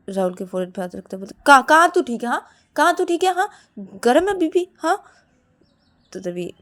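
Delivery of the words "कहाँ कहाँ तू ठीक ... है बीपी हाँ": high, loud challenge; disbelieving scolding. Acted. Shruti feeling her brother's forehead, scolding disbelief. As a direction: doubt him, voice rising. Shruti disputes Rahul is well.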